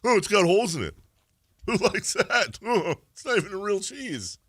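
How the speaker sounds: high-pitched mock complaint; mocking Swiss-cheese critic. Host imagining Whitey crapping on Swiss cheese, mocking high voice. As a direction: whine it high, in mock disgust. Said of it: "in Switzerland doing a show right now".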